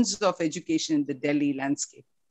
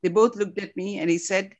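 ordinary talk

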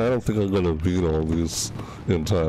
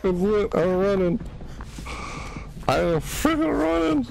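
high, frantic escape chatter; panicked; 11 semitones higher than usual. Real, not acted. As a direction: climb higher as you flee. Fleeing the killer.

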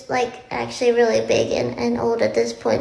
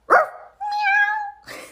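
burst of high laughter; 3 dB louder than usual. Real, not acted. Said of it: "laughing at own attempt".

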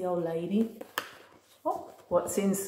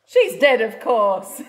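loud; 9 dB louder, slower than usual. Acted. Playful loud punchline.

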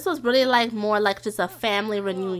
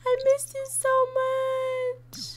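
very high, drawn-out mock-emotional squeal; gushing squeal; 14 semitones higher, slower than usual. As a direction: squeak it out slowly as if overcome. Pretend reunion, gushing over the character.

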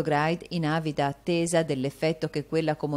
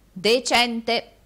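loud, higher one-word repeat; raised voice; 6 semitones higher, 6 dB louder than usual. A narrator's reading. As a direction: repeat it for someone hard of hearing. Arturo repeats for deaf woman.

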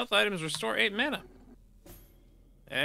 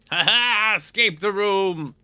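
triumphant escape; 3 semitones higher, 8 dB louder, slower than usual. Pretend. Rat escapes through the hole.

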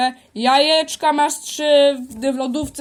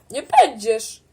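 ordinary talk

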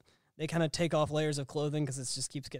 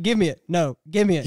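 loud, insistent retold demand; loud mock demand; 9 dB louder than usual. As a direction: bark it like a stubborn kid. Retold movie quote, loud.

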